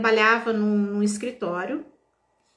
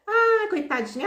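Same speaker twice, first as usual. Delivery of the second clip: imitating old lady; 10 semitones higher than usual. Begging old woman pities the crying speaker, high pitying coo. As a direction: coo over her like a kind old woman. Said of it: "Aí ela falou assim".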